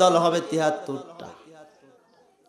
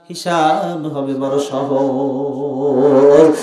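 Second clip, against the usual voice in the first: drawn-out emphasis; 5 dB louder, much slower than usual. Acted. Contrasting easy judgment, drawn out.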